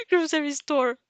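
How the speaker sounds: high laugh, then the word through it; giggling. Real, not acted. Narrator fixing a fumbled word, giggling. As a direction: laugh at her own slip.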